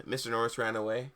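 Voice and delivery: ordinary delivery, nothing marked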